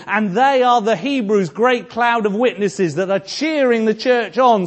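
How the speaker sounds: high, loud, ringing pulpit delivery; full-force preaching. Acted. Preacher lifting the congregation with Bernard's example, in a loud, high ringing voice. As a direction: preach it out to the whole church. Building sermon climax.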